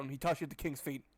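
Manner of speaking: ordinary delivery, nothing marked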